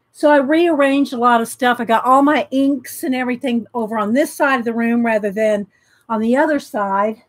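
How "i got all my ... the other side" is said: normal talking voice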